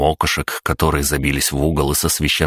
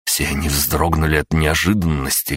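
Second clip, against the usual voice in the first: usual speaking voice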